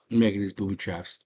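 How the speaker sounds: usual speaking voice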